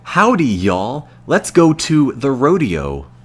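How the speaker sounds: a cartoon Texan cowboy, played for laughs; mock cowboy accent. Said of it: "if I talked American English in that tone".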